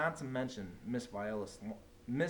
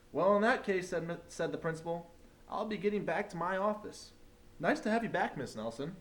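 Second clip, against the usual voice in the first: cheery principal; 6 semitones higher than usual. A narrator's reading. Principal leaving the classroom, cheerily relieved. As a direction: sound relieved and polite.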